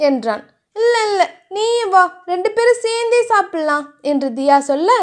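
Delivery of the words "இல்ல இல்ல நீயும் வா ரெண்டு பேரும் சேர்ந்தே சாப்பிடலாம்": put-on higher, coaxing girlish plea. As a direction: insist sweetly he eat with her. Narrator voices Thiya.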